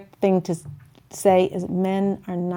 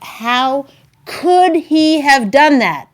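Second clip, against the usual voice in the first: hurt indignation; 5 semitones higher, 5 dB louder, much slower than usual. Retold or imitated. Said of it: "we're like".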